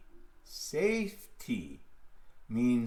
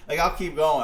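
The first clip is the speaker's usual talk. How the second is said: 1 louder insistence; 3 semitones higher, 9 dB louder than usual. Real, not acted. Repeats phrase, second louder.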